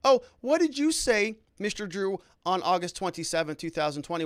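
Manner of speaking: bait the witness with fake sweetness; mock cross-examination. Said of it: "if the defense came back and said".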